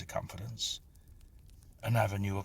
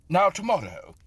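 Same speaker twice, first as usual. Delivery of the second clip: hearty host; 12 semitones higher, 9 dB louder than usual. A narrator's reading. Host announces plans heartily.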